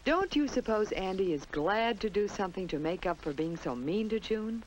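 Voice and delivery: ordinary delivery, nothing marked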